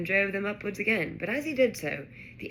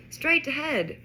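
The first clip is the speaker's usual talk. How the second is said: high-pitched excited call; shout; 8 semitones higher, 4 dB louder than usual. A narrator's reading. Harry directs Ron towards the castle, shouting. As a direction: call out the way ahead. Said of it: "Harry shouted".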